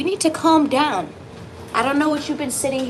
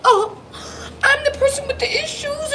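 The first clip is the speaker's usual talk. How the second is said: much higher mock whiny voice; mock the viewer's self-pity, pitched way up. Reader imitating a viewer's self-pitying thought, high and whiny. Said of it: "I don't want you to sit here and just think".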